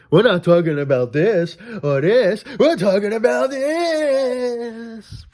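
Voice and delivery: high cartoon voice rising to a squeal on the last 'this'; SpongeBob quote. Vlogger quoting SpongeBob, high stretched cartoon voice. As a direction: quote the cartoon, climbing way up and slow. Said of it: "Remember from SpongeBob".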